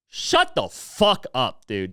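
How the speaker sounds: each word spat out slowly; slow angry put-down. Real, not acted. Ranting podcaster snapping at atheists, slow and scornful. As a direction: drag out the insult with contempt.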